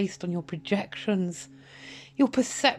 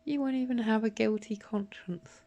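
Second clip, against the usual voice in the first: whispered, much softer; cold whisper; 6 dB quieter than usual. Real, not acted. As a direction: whisper it with contempt. Accusation in whisper.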